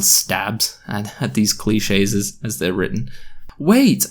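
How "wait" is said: high, mild narrated shout. A narrator's reading. Girl calling after departing boy, shouted. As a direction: call out to stop someone. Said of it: "I shouted to him".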